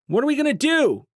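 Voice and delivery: high, loud frightened plea; panicked cry. A narrator's reading. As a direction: call out in alarm from a crowd. Panicked voice from the crowd.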